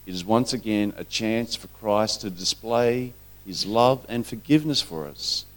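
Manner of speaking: ordinary talk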